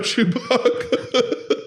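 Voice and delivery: breaks into laughter mid-phrase, slow; laughing. Real, not acted. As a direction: laugh it out, barely getting the words through. Cracking up mid-sentence.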